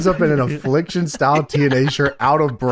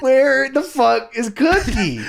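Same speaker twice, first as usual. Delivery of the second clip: laughing; 7 semitones higher, slower than usual. Retold or imitated. Repeating the quote, laughing.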